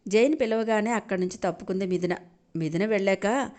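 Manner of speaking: ordinary talk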